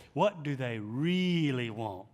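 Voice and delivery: slow question with REALLY stretched long; stress on REALLY. Retold or imitated. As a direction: imitate a mentor leaning hard on REALLY. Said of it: "He'd always emphasize really".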